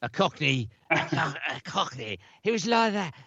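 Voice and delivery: swooping, hammed-up Cockney; Cockney Crypt Keeper. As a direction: do a Michael Caine sort of Cockney creature. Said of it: "He was like a Michael Caine type".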